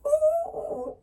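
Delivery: very high, loud startled "ooh"; a shrill yelp of fright; frightened cry. A narrator's reading. Lion sees the mouse, high frightened yelp.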